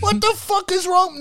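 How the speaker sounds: high-pitched indignant question; exasperated disbelief. Real, not acted. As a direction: voice shoots up in comic outrage. Ranting at the film's twist.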